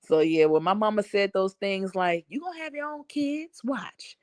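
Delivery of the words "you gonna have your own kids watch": whispered warning, much softer; mother softly warning her kids; mama's warning. Retold or imitated. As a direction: hush it like a knowing mother.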